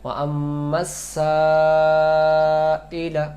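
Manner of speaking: ওয়া আম্মাস সাইলা drawn out slowly; slow careful recitation; correct long alif. Shown as a demonstration. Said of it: "এটা আদায় করতে হলে".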